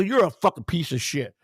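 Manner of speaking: ordinary delivery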